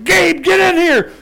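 high loud slowed call for help; urgent shout. Retold or imitated. Dad pinning the coon calls his son in, shouting. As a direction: yell for your son across the barn.

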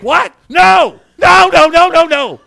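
loud, high shouted objection; hammed-up horrified yell; panicked parent. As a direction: frantically try to stop a child. Acting horrified parent hearing child swear.

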